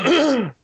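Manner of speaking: loud, confident throat clearing; big throaty ahem. Real, not acted. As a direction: clear the throat hard and boldly. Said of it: "it was so throaty and, like, confident".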